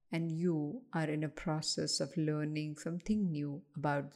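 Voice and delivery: unhurried, even-voiced relaxation coaching; guided meditation. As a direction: lead listeners gently through breathing, with pauses.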